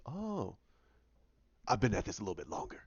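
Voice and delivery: quiet, quick smug comeback; smug undercut. Retold or imitated. Jefferson topping Oliver's years, in quiet smug quickness. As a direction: drop it softly and fast. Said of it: "He goes".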